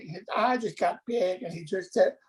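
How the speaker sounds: ordinary talk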